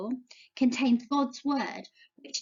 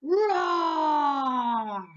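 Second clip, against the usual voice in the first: a mild roar, lightly done like a lion. Shown as a demonstration. Teacher demonstrating a mild lion roar for children. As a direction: show the kids a gentle lion roar. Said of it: "Can you do a roar like a lion?".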